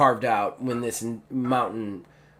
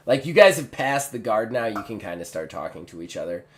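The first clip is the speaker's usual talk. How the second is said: usual speaking voice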